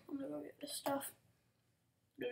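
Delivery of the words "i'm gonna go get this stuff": quick unvoiced whisper; fast whisper. Real, not acted. Player sneaking for leftovers, fast whisper. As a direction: hurry it under your breath, fast.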